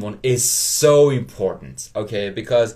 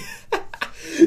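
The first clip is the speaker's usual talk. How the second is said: breathy laugh. Real, not acted. Laughing at own accent slip.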